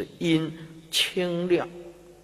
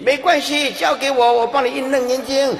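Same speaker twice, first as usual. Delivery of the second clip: loud, high, eager mock assurance; demonstrated hearty promise; 11 semitones higher, 7 dB louder than usual. Shown as a demonstration. Helper taking charge of the dying person's cash, loud and eager. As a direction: jump in at once, comically over-keen. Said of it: "对死人要这样讲的".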